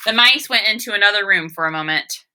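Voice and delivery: plain reading voice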